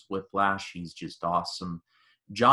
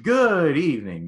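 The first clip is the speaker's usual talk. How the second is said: higher, louder, showy greeting; mock captain's announcement; 6 semitones higher, 7 dB louder than usual. Hangman announcing himself after saving them, showy mock announcement. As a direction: play the rescuer announcing himself.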